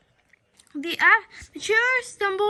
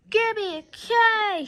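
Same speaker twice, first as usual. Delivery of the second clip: slow, higher chant; cheer shout; 5 semitones higher, 4 dB louder, slower than usual. Acted. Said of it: "shouted the cheerleaders".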